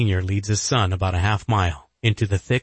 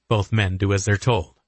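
ordinary delivery

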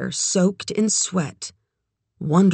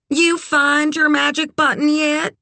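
high, nosy question; brassy, frank friend; 11 semitones higher, 4 dB louder than usual. A narrator's reading. Red quizzes Kit over the phone, brassy and frank. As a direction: quiz the novice, pitched way up.

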